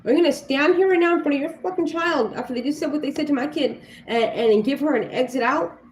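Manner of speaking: angry mother telling off another parent; furious confrontation. Retold or imitated. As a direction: tear into the parent with outrage. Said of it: "I said".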